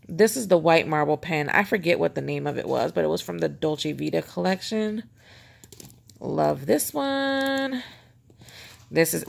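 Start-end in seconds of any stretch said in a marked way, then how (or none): 6.2-7.8 doting. Real, not acted. Fond pen praise.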